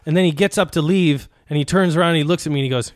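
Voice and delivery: normal talking voice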